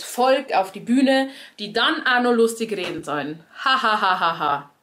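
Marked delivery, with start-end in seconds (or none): 3.6-4.7 flat mock "ha ha ha" in sarcasm; deadpan sarcastic laughter; fake laugh. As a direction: spell the laugh out with no joy in it. Mocking forced comedy laughter.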